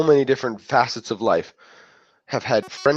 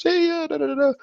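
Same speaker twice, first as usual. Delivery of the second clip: high, breezy sing-song chatter; carefree writer. Retold or imitated. Writer texting happily without a care, breezy high sing-song. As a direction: toss it off like you haven't a care.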